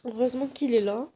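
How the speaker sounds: tearful, choked-up voice with a blocked nose; crying. Real, not acted. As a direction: speak through real tears. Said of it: "J'ai envie de pleurer encore".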